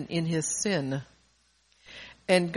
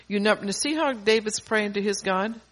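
ordinary talk, nothing marked

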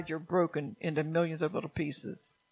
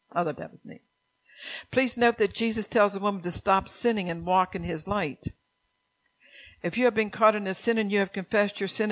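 usual speaking voice

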